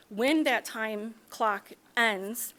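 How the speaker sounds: ordinary delivery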